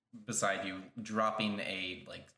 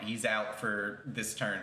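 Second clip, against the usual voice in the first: normal talking voice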